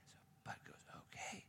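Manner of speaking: a soft whispered okay; whispering. Acted. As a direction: whisper it, going along. Teller whispers Bud's reply.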